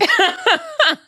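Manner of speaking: loud high laugh. Real, not acted. Recalling the gorilla insult, a loud high burst of laughter.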